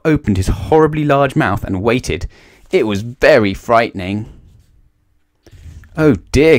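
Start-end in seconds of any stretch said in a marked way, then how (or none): 6.0-6.6 higher, louder alarmed gasp; scared boy gasping; frightened gasp. A narrator's reading. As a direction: gasp at the teeth below. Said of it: "gasped Jack".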